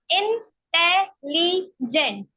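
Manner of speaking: Intelligent said slowly, syllable by syllable; broken into syllables. Shown as a demonstration. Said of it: "तो मैंने इसको break करके पढ़ा".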